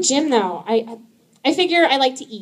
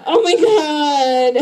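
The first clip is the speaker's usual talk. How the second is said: mock horror; 5 semitones higher, 5 dB louder, much slower than usual. Disgusted exclamation.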